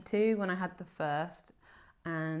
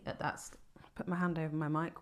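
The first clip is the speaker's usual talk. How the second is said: hushed aside off mic; whispering; 2 semitones lower, 7 dB quieter than usual. Real, not acted. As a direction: drop to a whisper. Host whispers while covering mic.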